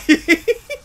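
short, high, loud laugh; burst of laughter. Real, not acted. Gamer laughs at winning by dying, short high laugh. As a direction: let a laugh pop out.

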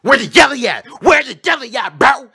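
high loud demanding bee voice; mock aggressive bee. Bee pressing the little kid for jelly, high loud demand. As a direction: get in the kid's face. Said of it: "He's like".

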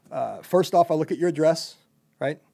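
ordinary talk

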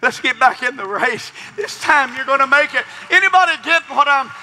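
high, loud, driving preacher cry; preaching at full force. Acted. Preacher pressing the crowd at full force. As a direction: preach it hard to the congregation.

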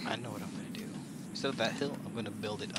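scheming whisper. Real, not acted. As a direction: whisper the plan to yourself. Scheming aside.

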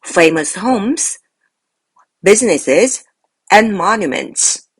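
"famous homes" and "businesses" rising, "and monuments" falling; slow, separated list: lift "homes" and "businesses", drop on "monuments"; parallel list intonation. Shown as a demonstration. Teacher models reading a parallel structure, slow list intonation. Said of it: "병렬 구조".

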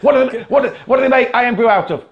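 excited joke setup. Real, not acted. Laughing setup of a Scottish joke.

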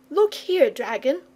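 put-on high, insistent young boy; jealous boy. A narrator's reading. Boy interrupting for his friend, indignant. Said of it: "interrupted the boy, a little jealous".

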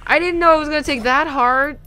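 high-pitched, plaintive complaint; whiny protest. Real, not acted. Player protesting the wrong heart taken, high whine. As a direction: protest your innocence up high. Protesting a costly game mistake.

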